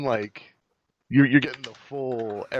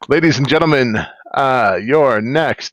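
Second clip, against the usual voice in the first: announcer voice; 7 dB louder, slower than usual. Acted. Hyping the next agenda card.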